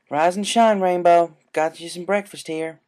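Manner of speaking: lightly voiced farm mare waking her friend; Applejack's cheerful greeting. A narrator's reading. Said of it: "Applejack greeted".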